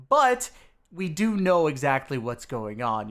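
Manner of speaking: usual speaking voice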